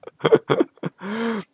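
a burst of high laughter; bursting into laughter at the memory; laughing. Real, not acted. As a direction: laugh at your own blunder. Laughs at his own blunder.

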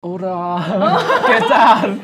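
laughing Spanish hello; Spanish greeting. Shown as a demonstration. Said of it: "所以你现在会讲西班牙语吗".

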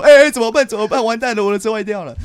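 high, loud flustered panic; mock panic. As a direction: squeal it like everything is falling apart. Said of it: "但如果平常我说".

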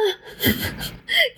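high, breathless giggle; squeaky laugh. Real, not acted. Host cracking up after mimicking porn moans, high giggle.

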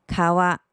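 川 read as Japanese "kawa", drawn out slowly. Shown as a demonstration. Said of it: "日文念成".

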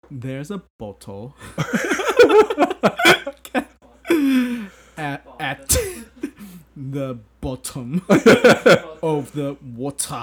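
slow British attempt, broken by laughter; laughing through a British accent. Shown as a demonstration. Student giggles through the British tongue twister. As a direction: try the British accent while cracking up. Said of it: "One more".

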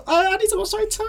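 squeaky high-pitched mock friend crying out; alarmed friend. Friend spotting the fallen rider, shrieks in a high mock voice. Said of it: "他说".

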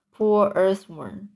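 plain reading voice

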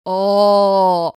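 a half-hearted holler; weak mock yell. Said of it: "很认真的".

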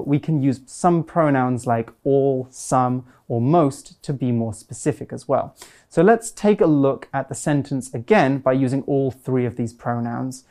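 ordinary delivery

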